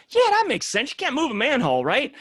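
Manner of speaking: high mock mason voice. Retelling the mason's easy agreement, a high mock voice.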